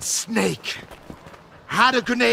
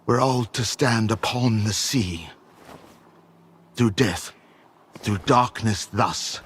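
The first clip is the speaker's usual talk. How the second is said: mystic recital; 10 semitones lower than usual. Acted. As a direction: intone it like a prophecy. Cryptic mystical speech.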